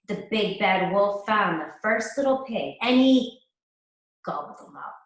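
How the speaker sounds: ordinary delivery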